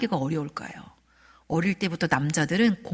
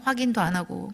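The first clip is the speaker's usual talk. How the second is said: ordinary delivery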